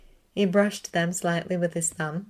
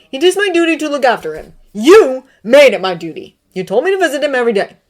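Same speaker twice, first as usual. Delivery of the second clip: higher and much louder, rising fury; angry outburst; 8 semitones higher, 13 dB louder than usual. A narrator's reading. Severus shouting at Dumbledore about his duty, angry. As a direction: shout it, the last line rushed.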